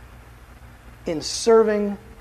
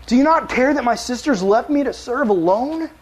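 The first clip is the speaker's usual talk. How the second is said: pleading Martha; 6 semitones higher, 4 dB louder than usual. Acted. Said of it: "she went up to him and said".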